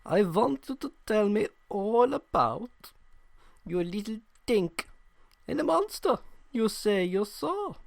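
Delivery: lightly voiced German interrogator; German accent. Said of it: "that's the accent we're going with".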